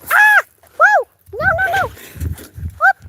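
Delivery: sudden high loud cries of alarm; startled shriek. Real, not acted. Owner startled as the dog crashes into the garden, high shriek. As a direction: yelp in surprise. Dog nearly knocks plants.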